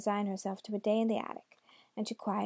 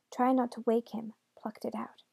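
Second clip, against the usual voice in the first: ordinary delivery, nothing marked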